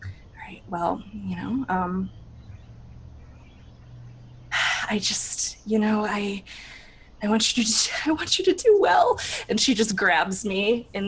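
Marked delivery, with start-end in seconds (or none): 0.0-9.2 a touch of a tearful mentor; tender whisper. Acted. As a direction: hold back tears while whispering. Mentor's emotional farewell.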